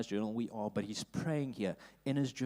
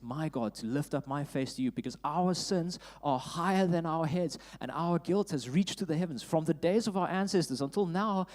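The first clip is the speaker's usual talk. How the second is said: rising to louder, higher pleading cry; anguished prayer; 5 semitones higher, 5 dB louder than usual. Acted. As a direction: plead with God in shame. Reads Ezra's anguished prayer.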